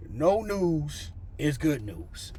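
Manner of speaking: very slow, stretched-out proverb; drawn-out saying. Retold or imitated. Mother-in-law's advice to nervous son-in-law, slow and deliberate. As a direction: draw out each word like sage advice.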